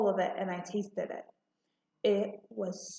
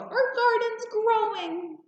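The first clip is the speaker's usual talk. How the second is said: high, loud and drawn out with delight; excited yell; 12 semitones higher, 4 dB louder than usual. A narrator's reading. Little girl calling out again in joy, high and slow. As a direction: shout the news, slower and bigger. Child yells again, overjoyed.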